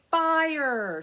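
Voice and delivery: a loud called-out fire; a demo shout of the word fire. Shown as a demonstration. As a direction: call it out like a warning to the grown-ups. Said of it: "That's right".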